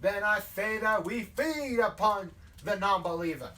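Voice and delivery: loud southern pulpit threat; southern hellfire preacher. Acted. Leader threatening to feed upon a non-believer, southern hellfire preaching. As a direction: thunder judgment on the non-believer. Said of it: "like, the hellfire and brimstone thing".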